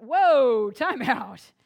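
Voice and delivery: sharp, loud parent's stop. Retold or imitated. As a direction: halt the child at once, loud. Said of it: "and I went".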